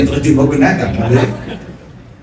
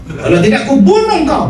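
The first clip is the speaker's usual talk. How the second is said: high, fast mock threat; hammed-up healer threat; fierce threat; 10 semitones higher, faster than usual. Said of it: "Ui, garangnya".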